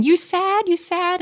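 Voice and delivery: put-on high little-kid voice repeating; high toddler repeating the question. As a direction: keep asking like a confused toddler. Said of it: "He just keeps saying".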